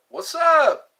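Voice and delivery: high, loud hello; excited greeting. Real, not acted. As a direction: call out the hello brightly. Greeting a viewer who joined.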